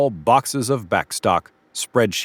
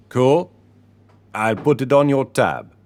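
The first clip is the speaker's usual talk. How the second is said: deep Caribbean lilt, pitched up; Caribbean shopkeeper's accent; 3 semitones higher than usual. A narrator's reading. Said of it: "He spoke in a deep Caribbean accent".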